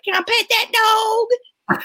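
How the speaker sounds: put-on high little-kid voice; squeaky-high imitation of a small child; imitating the kid. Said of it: "he's like".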